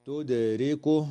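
تدركه with a bouncing echo on the د; qalqala on dal. Shown as a demonstration. Teacher isolating the word تدركه to show qalqala. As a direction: pop the د of تدركه with a small rebound, slowly. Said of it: "تقلقل الدال في كلمة".

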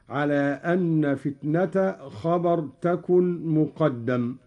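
normal talking voice

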